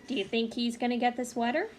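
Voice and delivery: ordinary delivery, nothing marked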